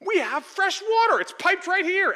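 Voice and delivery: man in the video gushing at his faucet; amazed joy. Retold or imitated. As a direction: marvel aloud at running water.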